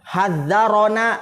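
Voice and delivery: "Hadzarona" slow, open a after the r; fathah on the ra. Shown as a demonstration. Teacher points students to the fathah, slow demonstrated reading. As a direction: draw out "Hadzarona", each syllable voweled. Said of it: "fathah dia".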